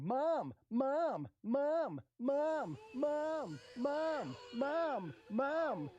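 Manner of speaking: imitated screaming kids. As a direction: lightly play whiny kids nagging for mom. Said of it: "With kids just screaming".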